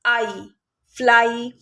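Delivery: आई alone, then blended as फ्लाई; sounding out. Shown as a demonstration. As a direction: say आई, pause, then join it into फ्लाई. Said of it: "one syllable word".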